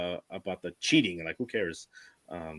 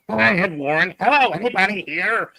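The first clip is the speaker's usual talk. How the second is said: loud, raised-pitch joke imitation of a ghost hunter; mock calling out; 6 semitones higher, 6 dB louder than usual. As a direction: call out playfully to the spirits. Jokingly replays recorder calling for spirits.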